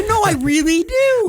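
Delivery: high, loud, eager fan protesting; insistent fan. Retold or imitated. Imagined fan insisting to the dismissive host, squealing. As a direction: squeal it like a fan who swears they listen.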